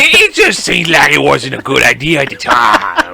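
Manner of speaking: slow Cajun drawl with a cracked yell; Cajun accent. Retold or imitated. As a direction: drag it out in a put-on Cajun accent. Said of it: "giving everyone a Cajun accent".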